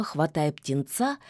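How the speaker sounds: plain reading voice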